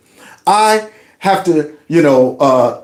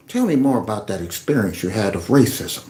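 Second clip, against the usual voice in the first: low, soft mock-sympathetic reporter voice; 9 semitones lower, 7 dB quieter than usual. Mocking the reporter asking the player about racism, low and soft. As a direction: coo like a fake-caring interviewer. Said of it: "And she's going".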